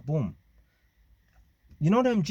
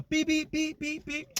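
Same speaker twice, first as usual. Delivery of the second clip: imitated car horn; 12 semitones higher, slower than usual. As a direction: mimic the horn going round the corner. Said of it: "beeping his horn".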